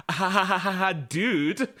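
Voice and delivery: spelled-out "ha ha", then feigned bafflement; forced laugh through teeth. Retold or imitated. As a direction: imitate someone laughing off an accusation. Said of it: "Gritting his teeth".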